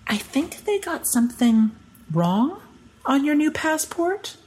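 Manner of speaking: high, slow voice for the official; imitated official, high and drawn out; hesitant official. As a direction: break bad news haltingly, pitched right up. Retelling a hesitant passport checker.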